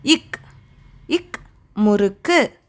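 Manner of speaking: இக், முறுக்கு spoken slowly and low; teacher linking a sound to a word for children; slow phonics model. Shown as a demonstration. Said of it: "என்னது வருது?".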